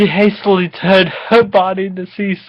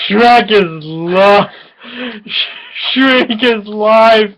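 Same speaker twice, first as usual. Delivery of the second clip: slow stretched; 4 semitones higher, much slower than usual. Acted. Slow drawn-out quote.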